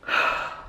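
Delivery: real weary sigh; frustrated sigh. Real, not acted. Upset patient venting about the missed cycles, weary sigh. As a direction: let out a sigh.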